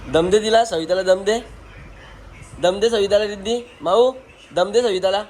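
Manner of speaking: high, playful urging of the cat; playful egging on. Real, not acted.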